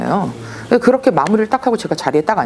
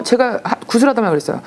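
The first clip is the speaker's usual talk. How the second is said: usual speaking voice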